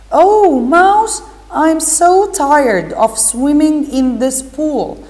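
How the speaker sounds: put-on high, lifted girl's plea; Alice's voice. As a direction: call to the mouse sounding weary of swimming. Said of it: "she said".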